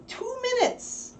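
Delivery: very high, slow, drawn-out coo; high slow pet talk. Real, not acted. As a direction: coax the puppy to wait. Pleading puppy to wait.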